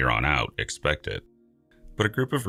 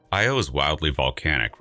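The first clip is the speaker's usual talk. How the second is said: ordinary delivery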